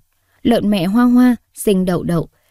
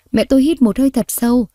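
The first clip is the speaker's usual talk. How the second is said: ordinary delivery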